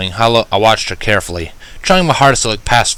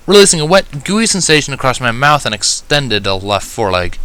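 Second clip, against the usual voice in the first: ordinary delivery, nothing marked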